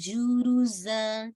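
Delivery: जुरुजा very slow, final जा stretched two counts; Quran recitation demo. Shown as a demonstration. Teacher to student, slow stretched जुरुजा. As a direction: draw out जुरुजा, holding the जा. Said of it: "जा को आपने टू count stretch करना है".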